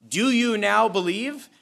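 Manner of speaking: mock the disciples' confidence slowly; sarcastic. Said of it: "dripping with sarcasm".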